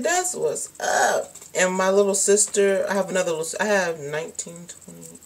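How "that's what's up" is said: slow, high, bemused drawl; dumbfounded. Retold or imitated. Reacting to dad's relief, slow and bemused. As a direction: let it sink in, baffled.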